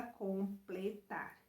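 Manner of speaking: "completar" broken into three syllables; split into syllables. Shown as a demonstration. Teacher asking pupils how to split the word, syllable by syllable. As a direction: say "completar" syllable by syllable, com, ple, tar. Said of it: "como é que eu separo as sílabas".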